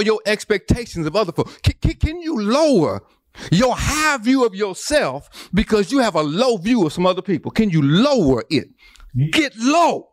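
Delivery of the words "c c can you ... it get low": high, rising preacher's cry, building to the call; preaching at full force. Real, not acted.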